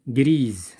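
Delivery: "Grise" loud, ending on a voiced z; S prononcé Z. Shown as a demonstration. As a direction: say "Grise" firmly, with a buzzing final z sound. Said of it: "il se prononce Z". (« Grise » fort, avec le S final prononcé Z)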